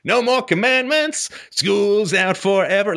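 hammed-up giddy freedom cry; mock celebration. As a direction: cheer like kids let out of school. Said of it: "it's like".

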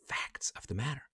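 whispered aside. Real, not acted. Whispering the bottom line to a listener. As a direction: drop to a whisper for the last words.

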